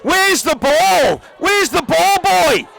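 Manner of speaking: high, loud urgent shout. Real, not acted. Commentator yelling at the players for the ball, loud. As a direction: yell it across the field. Calling out during final play.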